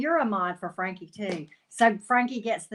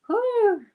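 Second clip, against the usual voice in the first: high relieved whew; 13 semitones higher, 8 dB louder than usual. Real, not acted. Relief at thought of barn day.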